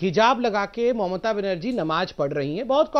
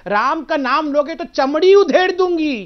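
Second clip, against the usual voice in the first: much louder, slower, a bit higher hammed-up threat; mock threat; 4 semitones higher, 9 dB louder than usual. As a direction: ham up a furious stage threat. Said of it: "उतर के कह रही थी". (नकल करते हुए ज़ोरदार धमकी)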